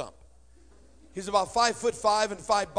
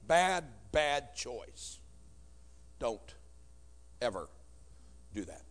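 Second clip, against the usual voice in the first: slow, low, dropping-away warning; mock solemn; 8 semitones lower, 8 dB quieter, slower than usual. Comedian warns the congregation, mock solemn. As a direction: drag it out solemnly for effect.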